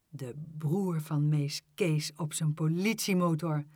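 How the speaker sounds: ordinary delivery, nothing marked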